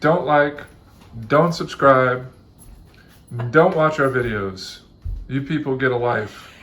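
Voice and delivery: loud, slow grumpy rant; mock grumpy shout. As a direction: bark it like a cranky old man. Joking anti-outro, shouting at viewers.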